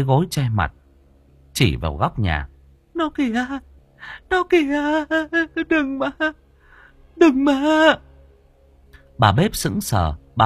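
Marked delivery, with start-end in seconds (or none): 2.9-7.6 very high panicked cries; shrieky, pleading panic; terrified. A narrator's reading. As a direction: beg it away in sheer terror. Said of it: "Đào Nương kinh hãi".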